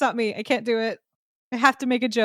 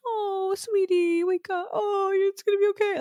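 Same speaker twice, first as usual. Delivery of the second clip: sappy mom; 10 semitones higher than usual. Retold or imitated. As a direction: coo like a mother at a hospital bed. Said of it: "my mom being like".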